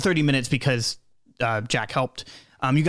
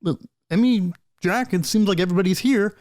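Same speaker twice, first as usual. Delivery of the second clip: Blue's voice; 5 semitones higher than usual. Acted. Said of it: "Blue goes".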